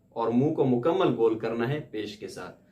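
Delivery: usual speaking voice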